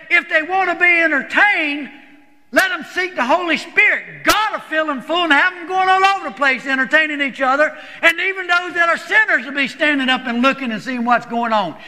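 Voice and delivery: high, driving preacher's exhortation; fervent retelling of an emphatic pastor. Retold or imitated. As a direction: keep the pitch up and press on insistently. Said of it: "he was emphatic about it".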